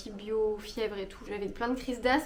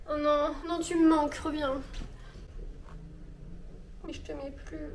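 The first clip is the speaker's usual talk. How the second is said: mock heartbroken; 4 semitones higher than usual. Mock farewell to skirt.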